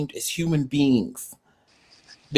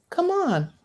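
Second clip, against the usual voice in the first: much higher, sing-song coaxing; coaxing pet; 13 semitones higher, 5 dB quieter than usual. Real, not acted. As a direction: call the pet in a cutesy high voice. Calling a pet to play.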